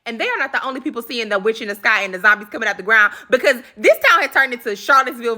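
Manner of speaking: ordinary delivery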